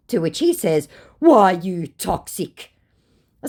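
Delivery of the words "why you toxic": mocking scammer voice. Reading the scammer's reply.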